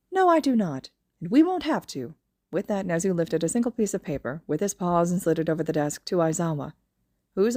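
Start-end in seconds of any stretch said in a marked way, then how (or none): 0.1-2.2 high, brisk, smug voice; high principal voice. Acted. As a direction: talk fast and pleased with yourself. Nezu's voice.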